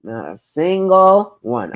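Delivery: ordinary talk, nothing marked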